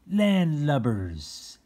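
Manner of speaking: slow, high, loud pirate scorn; pirate insult. Shown as a demonstration. Reader shows kids a pirate insult loudly. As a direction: sneer it out like an old pirate.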